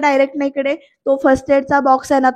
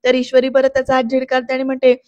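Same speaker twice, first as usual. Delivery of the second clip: normal talking voice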